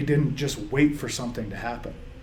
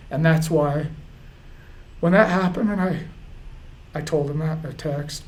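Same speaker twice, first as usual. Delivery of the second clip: higher, halting, tearful delivery; choked up; 4 semitones higher than usual. Real, not acted. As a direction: push through real tears in short phrases. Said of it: "this is awful".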